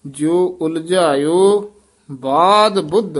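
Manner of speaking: ਜਿਉ ਉਲਝਾਇਓ ਬਾਦ ਬੁੱਧ slow, ਬਾਦ weighted; slow careful. Shown as a demonstration. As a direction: give ਬਾਦ weight and go slowly. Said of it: "ਧਿਆਨ ਨਾਲ ਉਚਾਰਨ ਕਰਨਾ ਹੈ". (ਬਾਦ ਉੱਤੇ ਜ਼ੋਰ, ਹੌਲੀ)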